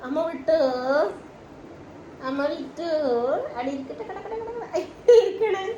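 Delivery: high, sing-song nonsense cooing. Real, not acted. Baby talk while cutting nails.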